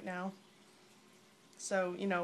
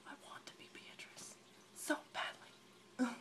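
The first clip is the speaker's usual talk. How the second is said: whisper; 4 semitones higher, 9 dB quieter than usual. Real, not acted. Longing to play Beatrice.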